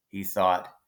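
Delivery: usual speaking voice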